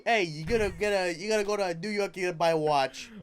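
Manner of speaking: insistent Italian-American street patter, played for laughs; Italian-American accent. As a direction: badger someone in a hammed-up Italian-American accent. Trading mock Italian-American voices.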